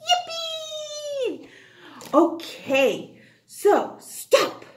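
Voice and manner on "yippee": excited cheer. Acted. Child cheering.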